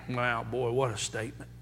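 low, very soft reaction; quiet, low-pitched aside after preaching; hushed aside. Real, not acted. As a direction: drop the voice right down, almost to yourself. Hushed reaction after quoted harsh verdict.

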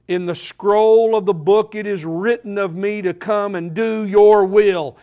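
rising to a loud proclaiming peak; proclaimed. Acted. Preacher proclaiming the verse to his church, loud and building. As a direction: ring out the scripture to the congregation.

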